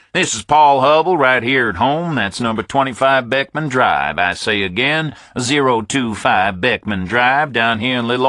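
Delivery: put-on Southern accent, jokingly testing the line; a Southern caller hams up a signal test; playful southern mic check. A narrator's reading. Hubble recites address playfully.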